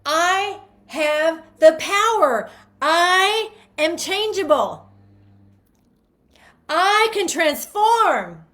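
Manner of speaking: high, loud, slow proclamation; joyful affirmation. Shown as a demonstration. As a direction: declare it with triumphant joy.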